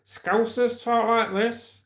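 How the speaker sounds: high, drawn-out Scouser voice; Scouse accent. Shown as a demonstration. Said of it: "Right, that's Scouser".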